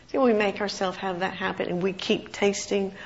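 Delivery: ordinary talk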